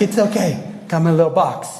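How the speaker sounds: high, breezy reassurance; casual Michaela. Retold or imitated. Michaela calmly reassuring her panicked friends, breezy and high. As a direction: toss it off lightly. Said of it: "She's like".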